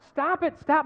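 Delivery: high, loud pained yell; screaming out. Retold or imitated. Patient to doctor wrenching his sore arm, screaming out. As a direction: yell it out in agony, pitch way up. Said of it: "And I'm screaming out".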